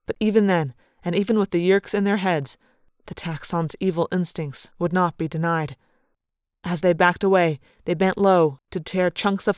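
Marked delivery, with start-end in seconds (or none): none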